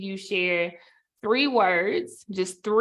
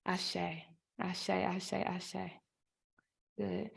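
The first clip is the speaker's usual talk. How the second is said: soft affirmation; 12 dB quieter, slower than usual. Real, not acted. Affirming each speaker's words.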